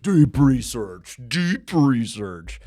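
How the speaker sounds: booming slow announcer catchphrase; slow trailer voice. Acted. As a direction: draw it out big and slow. Repeated catchphrase.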